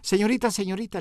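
mild mock shout across the restaurant; calling the waitress, half-hearted shout. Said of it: "le grité a la mesera".

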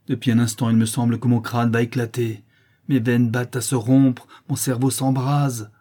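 loud, agitated torment; forceful, tormented outcry; mounting anguish. A narrator's reading. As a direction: build as if your head will burst. Brigand describes his unbearable torment.

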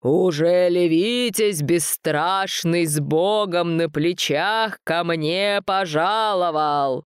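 slow, hollow booming mumble; a monster droning from inside a pillar. A narrator's reading. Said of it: "забубнила гулко".

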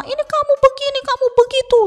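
very high-pitched mock nagging; sudden scolding. Parents scolding without prior rules, in high-pitched mock anger. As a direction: imitate a parent suddenly telling a child off. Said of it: "tiba-tiba marah-marah".

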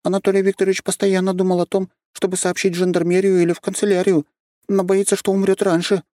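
lightly voiced young girl; high girl voice. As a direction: speak up high like a small girl. Girl voice continues.

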